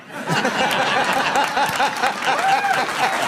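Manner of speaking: high loud laughter; big laugh. Real, not acted. Remembering Farley's coat gag, a loud high laugh. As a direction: laugh hard at the memory.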